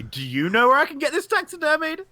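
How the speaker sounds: high-pitched call to a stranger. Acted.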